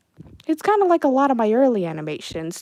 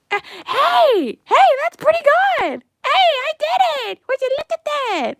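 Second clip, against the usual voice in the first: much higher, louder, thrilled; excited shouting; 6 dB louder than usual. Real, not acted. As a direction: cheer over a finished animation. Animation works, celebrating.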